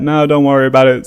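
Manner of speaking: hurried no-thanks; polite refusal. Retold or imitated. Some officers politely declining tea, quick and loud. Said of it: "some were like".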